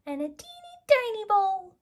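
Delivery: put-on squeaky high teeny tiny; high squeak on teeny tiny. A narrator's reading. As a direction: make the words sound tiny.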